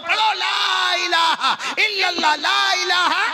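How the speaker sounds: very high, urgent exhortation; fervent preaching. Acted. Teacher urging students to recite at the deathbed, fervent. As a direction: drive it high, stretching the sacred words.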